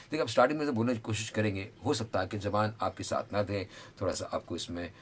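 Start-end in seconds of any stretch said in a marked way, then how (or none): none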